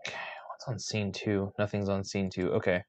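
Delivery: hushed aside, almost unvoiced; whispering to himself. Real, not acted. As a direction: mutter it under your breath. Muttering to himself about the scenes.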